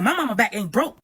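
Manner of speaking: high, loud mock-indignant comeback; sassy attitude. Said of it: "Used to have a whole attitude like".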